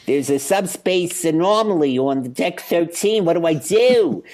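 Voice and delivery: flustered Philly crewman reporting to the captain; hammed-up Philadelphia accent. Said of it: "they don't want anybody going".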